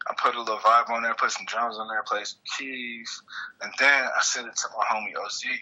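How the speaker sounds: ordinary delivery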